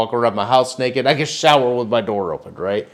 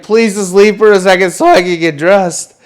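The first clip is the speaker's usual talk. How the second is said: raised, louder plea; urgent calling out; 7 semitones higher, 6 dB louder than usual. Retold or imitated. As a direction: call urgently through a shower curtain. Calling to intruders from the shower.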